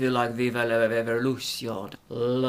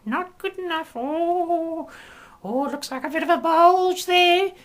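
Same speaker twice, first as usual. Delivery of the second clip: high, loud shrill-free mock of prim old biddies; mocking prudish old ladies; 4 dB louder than usual. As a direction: imitate a scandalised blue-rinse lady, much higher. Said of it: "their blue rinse knitting set ... going".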